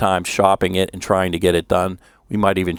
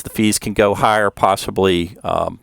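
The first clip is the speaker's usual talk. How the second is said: ordinary talk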